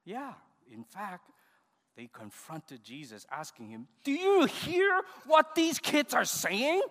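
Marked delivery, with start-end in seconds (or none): 4.0-6.8 high, loud, slow mock outrage; indignant confrontation. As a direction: confront him like an outraged priest, stretching the words. Priests indignantly confront Jesus, acted.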